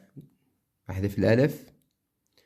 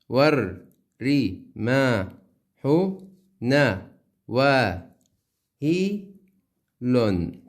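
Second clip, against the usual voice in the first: loud separated syllables, slowly; 4 dB louder, much slower than usual. Shown as a demonstration. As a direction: spell out each syllable with a pause. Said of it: "تمام؟". (تقطيع مقطعي بطيء)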